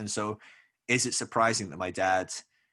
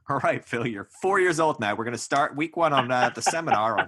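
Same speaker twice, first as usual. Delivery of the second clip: louder, higher pretend parent addressing a small child; mock parent announcing; 4 semitones higher, 7 dB louder than usual. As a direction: joke seminar-leader voice, pitched up and loud. Imagined parent starting emotions seminar.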